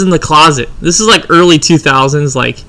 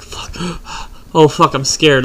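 acting out waking in fright, a gasp midway; breathless first word, then a mild fearful gasp; mock scared; 3 dB quieter, much slower than usual. Retelling waking up from sleep paralysis, mock scared. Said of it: "go into a state of fear".

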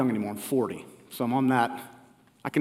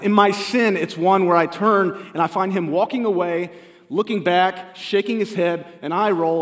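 ordinary delivery

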